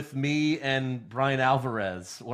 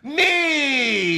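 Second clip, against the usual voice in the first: high, loud shouted diva yell; Carmella impression; 12 semitones higher, 5 dB louder than usual. Co-host answering his own question, in a shrieking Carmella voice. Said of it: "That was my Carmella impression".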